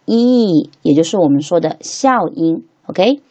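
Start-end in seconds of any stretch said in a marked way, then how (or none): 0.1-0.7 E held long as a pure ee; long vowel E. Shown as a demonstration. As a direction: draw out E like a clear ee. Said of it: "它发那个长元音".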